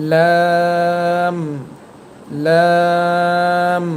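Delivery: লাম drawn out slowly with a held l; Arabic letter demo. Shown as a demonstration. Teacher drilling লাম for learners. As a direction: stretch লাম long. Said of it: "এর পরের হরফটি".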